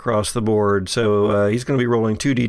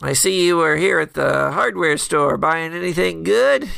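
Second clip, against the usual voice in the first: nasal voice; 5 semitones higher than usual. Acted.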